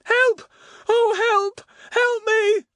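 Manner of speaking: high, loud, desperate screams for help; anguished cry for help. A narrator's reading. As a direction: cry out in terror, trapped. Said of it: "Then came an anguished voice".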